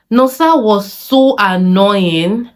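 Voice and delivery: slow, stretched, rising on Nosa; exasperated slow drawl; slow exasperated complaint. Acted. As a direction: drag Nosa out in disbelief.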